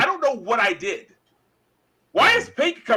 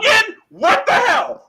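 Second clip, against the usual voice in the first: slow, high, loud complaint; exasperated outcry; 11 semitones higher, 5 dB louder, slower than usual. Real, not acted. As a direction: throw up your hands vocally.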